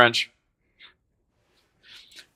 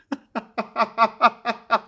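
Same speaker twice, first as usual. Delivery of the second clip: high, staccato giggling; amused laugh; 10 semitones higher than usual. Real, not acted. Streamer laughing at Tim's angry text, high giggle. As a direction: burst into a run of high giggles.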